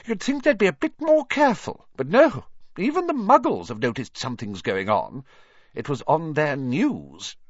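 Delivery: high, fussy professor's voice; disapproving professor. Acted.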